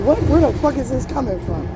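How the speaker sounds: mock shocked retort; incredulous. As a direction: throw the question up in disbelief. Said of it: "It's like".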